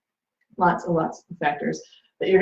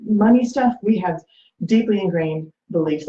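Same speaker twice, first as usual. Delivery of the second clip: usual speaking voice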